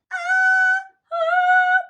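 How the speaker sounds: very high, loud falsetto ah. Shown as a demonstration. Teacher demonstrating falsetto on ah, very high. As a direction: float the ah way up in falsetto. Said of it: "El otro registro se llama falsete".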